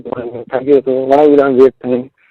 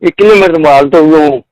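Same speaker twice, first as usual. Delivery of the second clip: louder, excited recounting; excited loud telling; 4 semitones higher, 7 dB louder than usual. Real, not acted. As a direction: raise the voice as if still amazed. Excitedly recounting crowd following. (ઉત્સાહમાં મોટેથી કહેવું)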